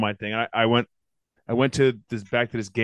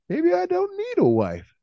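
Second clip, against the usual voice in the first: much higher, slow, preening put-on voice; admiring his painted nails, mock preening voice; mock vain self; slower than usual. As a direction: coo it to yourself in the mirror, hammed up. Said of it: "I'm like".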